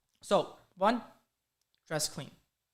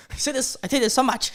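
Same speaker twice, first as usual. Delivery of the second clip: high, loud, breaking into laughter; laughing; 5 semitones higher, 5 dB louder than usual. Real, not acted. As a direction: laugh at your own catchphrase. Laughing at own repeated advice.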